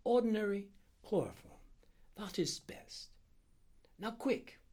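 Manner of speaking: high-pitched doctor, firmly settled; decisive Indian doctor. Acted. Doctor having chosen the anesthetic, high and decisive. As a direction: confirm the choice and press on.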